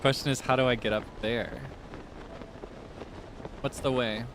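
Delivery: ordinary talk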